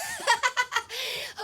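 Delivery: high, bursting real laugh; cracking up at the question; burst of laughter. Real, not acted. Friend teases about late DJ.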